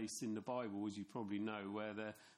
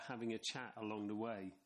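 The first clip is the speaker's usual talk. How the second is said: ordinary delivery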